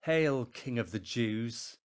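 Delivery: loud, slow mock salute; mocking hail. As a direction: hail him slowly in mockery. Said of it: "knelt in front of him and mocked him".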